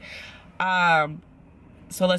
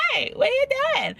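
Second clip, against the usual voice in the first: much higher, sing-song phone greeting; a tipsy hey pitched way up; squeaky drunk call. Retold or imitated. Drunk Micah calling Paul, squeaky and sing-song.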